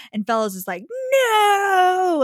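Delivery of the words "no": high, loud wailing no; Fellows' hammed-up despairing no; mock anguished no. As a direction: cry it out in denial.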